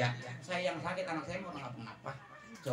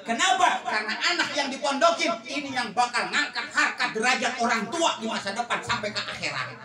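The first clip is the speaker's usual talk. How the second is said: loud, high sermon delivery with bursts of laughter; fiery preaching; 8 semitones higher, 8 dB louder than usual. Acted. Preacher telling the crowd about the neighbour's son, loud sermon voice. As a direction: hammer the point home to the congregation.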